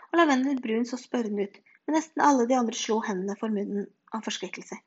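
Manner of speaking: usual speaking voice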